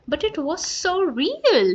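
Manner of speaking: high, slow plea; upset girl. Acted. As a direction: still shaken from the dream. Scared girl after nightmare.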